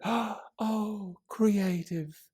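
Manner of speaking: high, soft, airy coo; admiring mom. A narrator's reading. Mom admires her daughter's painting, high soft coo.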